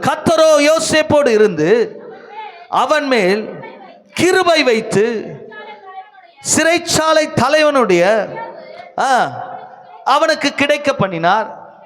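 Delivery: high, slow, ringing declamation; proclaimed scripture reading. Real, not acted. Preacher reading Joseph's prison verse to the congregation, high and declamatory.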